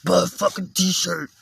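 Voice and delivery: high, loud gym-bro yell at the camera; swole dude yelling. Retold or imitated. Gym guy yelling at his camera viewers, loud mock yell. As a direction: bark it like a pumped-up meathead. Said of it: "like that big swole dude who's always yelling at the camera".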